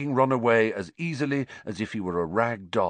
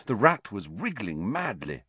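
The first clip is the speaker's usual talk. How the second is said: plain reading voice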